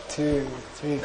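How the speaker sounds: ordinary talk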